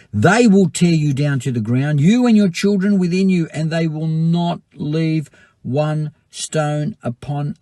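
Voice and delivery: a loud prophetic warning, slowing down; preaching at full force. Acted. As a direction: thunder the warning. Forceful scripture reading.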